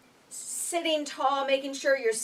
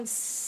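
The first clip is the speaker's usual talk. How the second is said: long unvoiced snake hiss; steady breathy hiss like a snake; 9 dB quieter than usual. Shown as a demonstration. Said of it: "we're gonna make a S sound".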